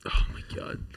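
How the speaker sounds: unvoiced whispered aside. Reacting to the story in a whisper. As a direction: hush it out.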